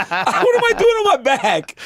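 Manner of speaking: high, slow, laughing quote; mock dismay through laughter; laughing self-mockery. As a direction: voice your own bewilderment while cracking up. Laughing as he retells his thought.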